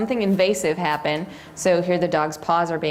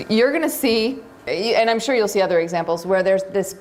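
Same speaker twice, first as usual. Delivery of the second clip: usual speaking voice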